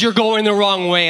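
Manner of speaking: loud warning, half screamed; retold scream. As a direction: imitate a wife's alarmed yell. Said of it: "at the top of her lungs screams".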